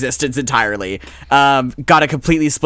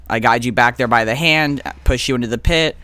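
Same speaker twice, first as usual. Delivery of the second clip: ordinary talk, nothing marked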